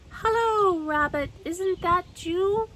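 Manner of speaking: high, loud call down a burrow; calling into a hole. Acted. Pooh calling into Rabbit's hole, high and loud. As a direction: call out hopefully into the dark hole.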